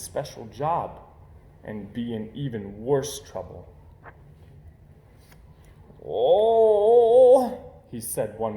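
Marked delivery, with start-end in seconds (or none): 6.1-7.6 fake groan. Brother faking sickness.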